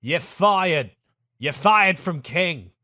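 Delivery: high, loud British bluster; pompous British ambassador telling someone off; Jeremy Clarkson. Acted. Ambassador firing Farouk from being king, blustering British voice. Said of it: "our fucking Jeremy Clarkson".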